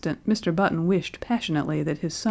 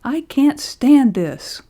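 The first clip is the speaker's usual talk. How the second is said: desperate cry; 6 semitones higher, 6 dB louder, much slower than usual. A narrator's reading. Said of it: "he cried hoarsely".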